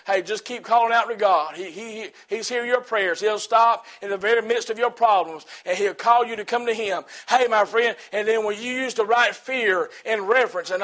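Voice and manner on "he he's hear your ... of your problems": normal talking voice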